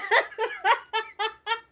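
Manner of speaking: high squealing laugh, real; burst of laughter. Real, not acted. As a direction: break into a high helpless laugh. Laughing at own story.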